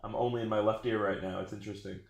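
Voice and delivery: distant, soft off-mic voice; far from the mic. Real, not acted. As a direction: speak from across the room. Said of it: "sounded like you walked to the other side".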